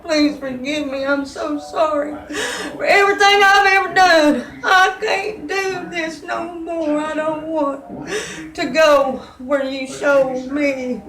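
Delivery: high, loud, broken pleading cry; begging God for forgiveness, crying and shouting; retold tearful scream. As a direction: scream out a desperate sobbing prayer. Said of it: "And I was screaming".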